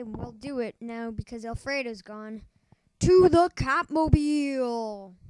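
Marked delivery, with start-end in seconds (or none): 3.0-5.2 heroic shout. Acted. Hero calls out.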